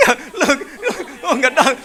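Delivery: chuckling through the words; laughing. Real, not acted. Preacher teasing the lovers who stay home, laughing. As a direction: laugh while teasing them.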